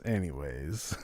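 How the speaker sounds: soft mumble. Acted. Co-host mumbles softly, deflated after his own slip. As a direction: let the anyways sink into a low mumble.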